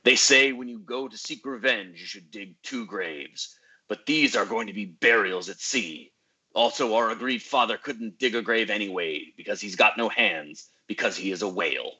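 dramatic trailer narration; movie trailer voice. Acted. Movie trailer style intro.

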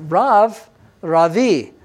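"Rav" a short RAHV, then "Ravi" RAH-vee; "Rav", then "Ravi" with an added "ee"; Hebrew rav, ravi. Shown as a demonstration. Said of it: "So you would say".

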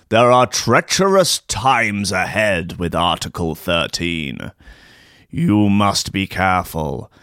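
slow, low, hammed-up spectral voice; ghostly warning. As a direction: warn them solemnly like a haunting spirit. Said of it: "ghostly apparitions form".

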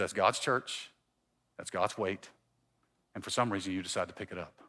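very low, soft counsel; quiet elder. Retold or imitated. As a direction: imitate an elder's hushed, grave admonition. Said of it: "is this quiet elder".

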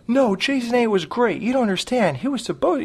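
high-pitched mock fanboy protest; obsessed fanboy. Podcaster mocking a fanboy defending a movie, high obsessed-fan voice. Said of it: "A fanboy is usually, like, obsessed".

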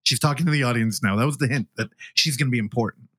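ordinary talk, nothing marked

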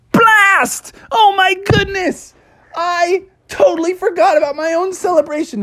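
fluster like a late cartoon groundhog. Phil oversleeps.

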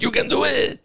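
high unvoiced mock shout; whisper-shout the catchphrase high; catchphrase impression. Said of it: "a lot of people are like".

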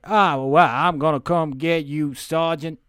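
menacing Southern accent, hammed up; Southern gang leader. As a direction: threaten in a put-on Southern accent. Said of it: "the leader of this three".

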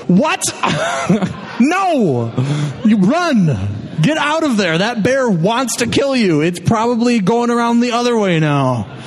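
high-pitched, incredulous scolding; mock exasperated urging. Host to bear victim taking a selfie, mock exasperated urging.

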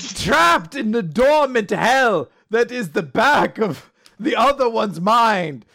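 mock tragic. Dramatic self-pity about jokes.

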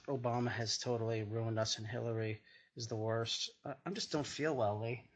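normal talking voice

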